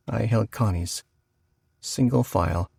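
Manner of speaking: ordinary delivery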